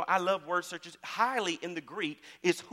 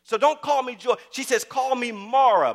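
angry retort; 6 semitones higher, 8 dB louder than usual. Retold or imitated. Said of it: "one day she was so mad".

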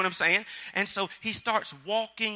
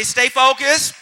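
call and response; 6 semitones higher, 12 dB louder, slower than usual. Acted. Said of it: "Everybody say".

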